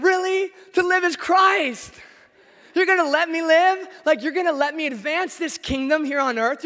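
high and loud, bursting with joy; overjoyed, can't believe his luck; delighted Paul. Retold or imitated. Paul to his captors, thrilled to keep living.

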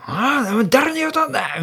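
high mock yell at the other guy. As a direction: play the old man shouting back, pitched high. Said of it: "もうクソ大声で".

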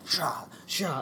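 soft swishing stroke noises; imitated arm strokes. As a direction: imitate arms sweeping through water. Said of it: "it's like my arms are like".

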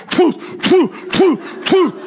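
high, playful poof sounds for each ball; mock firing noises. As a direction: pop out each shot like a toy cannon. Boy firing baseballs at plane.

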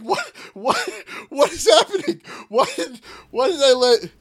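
high, loud squeal of alarm; baffled disbelief. Retold or imitated. Player retelling zoning back in to an army plan, baffled disbelief. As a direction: sputter it out in panicked bafflement, breaking into laughter.

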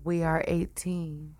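slow, soft phone-sex line voice; sultry hotline voice. Pretend. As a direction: purr it slowly like a hotline ad. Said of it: "dudes with the hotline voice".

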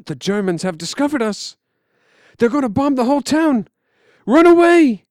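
rising from urgent to a high full scream; building to a scream. Acted. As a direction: panic climbing until he screams run. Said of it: "and then he screams".

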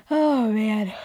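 words stretched into a yawning sigh. Real, not acted. Host yawning midway through the recap, real yawn. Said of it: "I'm sorry I keep yawning".

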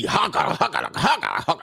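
high, rapid-fire harsh gibberish Swedish; aggressive mock Swedish. Women talking in their own language, aggressive mock Swedish. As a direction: rattle off fake Swedish syllables aggressively. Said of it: "when they talking in, in their language".

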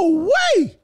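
high, loud falsetto woo-wee; excited whoop. Real, not acted. Reacts loudly to pictures.

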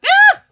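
short, high, loud imitated yelp; mock high-pitched yelp of excitement; excited little yelp. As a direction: let out a sudden excited squeal. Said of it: "lets out this excited little yelpy".

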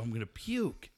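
normal talking voice

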